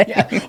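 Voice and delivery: short nervous laugh before a word. Real, not acted. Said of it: "that was almost like a nervous laughter".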